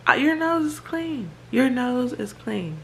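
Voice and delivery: high-pitched exasperated retelling of her reply; exasperated mom. Retold or imitated. Mom to crying son about his clean nose, high and exasperated. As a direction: replay your own baffled, fed-up answer. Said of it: "So I'm like".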